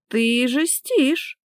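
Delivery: high, drawn-out sulky protest; reluctant complaint. Acted. Wife unwilling to obey husband, high sulky complaint. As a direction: resist with a slow whine. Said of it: "нахмурилась она, не желая подчиняться".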